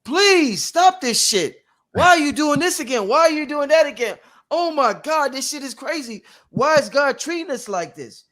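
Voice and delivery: high, pleading mock scream; screaming cells. Speaking as the body's abused cells, screaming in protest. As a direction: cry out in exasperated, high-pitched protest. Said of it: "our cells are probably screaming".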